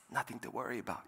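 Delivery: hushed passenger's reassurance; murmured aside. Acted. Passenger leaning over to a worried flyer, quiet aside. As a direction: lean over and say it softly. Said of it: "a passenger leans over and says".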